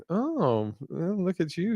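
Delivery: airy mock-impressed aside; a hint of mock awe. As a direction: tease him like he's showing off. Mock reaction to the comment.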